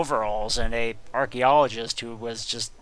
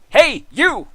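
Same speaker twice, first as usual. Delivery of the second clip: much higher, louder yell; angry call-out; 13 semitones higher, 9 dB louder than usual. Acted. The beaten guard spots his attacker, angry yell. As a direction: accuse him on the spot.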